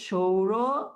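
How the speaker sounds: সৌরজগৎ as "shou-ro... jogot", split by a pause; palatal sh. Shown as a demonstration. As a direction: stretch সৌরজগৎ, opening on sh. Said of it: "তালব্য শ উচ্চারণ হচ্ছে".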